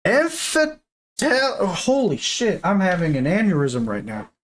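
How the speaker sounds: normal talking voice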